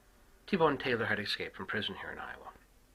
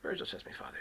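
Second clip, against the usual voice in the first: usual speaking voice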